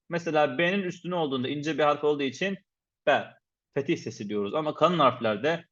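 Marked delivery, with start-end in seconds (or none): none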